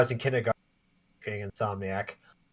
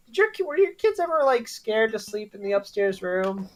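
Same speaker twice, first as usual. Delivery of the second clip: curious question; 8 semitones higher than usual. Retold or imitated. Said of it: "I wanna be like".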